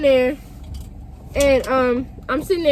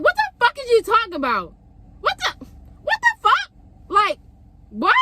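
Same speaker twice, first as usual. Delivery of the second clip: shocked outburst; 9 semitones higher than usual. Retold or imitated. Retold outburst at boyfriend.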